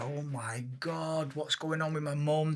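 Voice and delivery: worried aside, retold under the breath; hushed dismay. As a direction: drop the volume for quiet disbelief. Retells alarmed reaction to suicidal mother.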